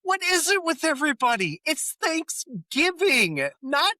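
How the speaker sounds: high exasperated outburst; frantic high rant; Ross losing it. Retold or imitated. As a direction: throw up your hands, fed up. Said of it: "the other line of his I love".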